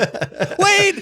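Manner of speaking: high-pitched laugh through the word. Real, not acted. Host cracking up at Barry's sound clip, laughing. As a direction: caught mid-giggle, barely getting it out.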